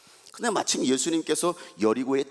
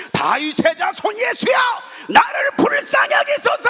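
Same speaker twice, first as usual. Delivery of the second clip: much higher, louder slow cry for mercy; desperate shout; 10 dB louder, slower than usual. Retold or imitated. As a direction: shout it slowly to be heard over the crowd. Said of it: "하고서 소리를 지르는 거예요". (절박하게 크게 외치기)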